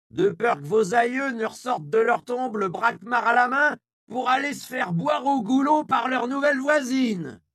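put-on high, loud hoarse old woman's shout, slowing; hoarse powerful yell. A narrator's reading. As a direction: yell down the crowd like a town crier. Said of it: "reprit Isia". (la vieille Isia hurle à la foule, voix rauque et puissante)

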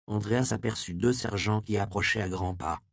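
ordinary delivery, nothing marked

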